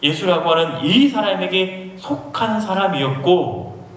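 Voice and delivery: ordinary talk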